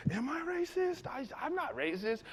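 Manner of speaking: high, soft, bewildered questioning; small high voice of confusion; self-doubting. Retold or imitated. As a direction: voice an anxious inner thought. Teller doubts himself silently.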